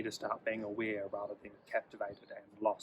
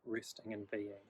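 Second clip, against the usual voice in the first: guided meditation. Acted. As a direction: ease the listener into stillness, gently.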